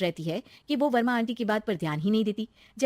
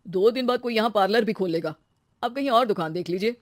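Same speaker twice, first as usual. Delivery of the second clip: lightly voiced male landlord; dismissive shop owner; 4 dB louder than usual. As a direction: send her off to look elsewhere. (टालता हुआ दुकान मालिक)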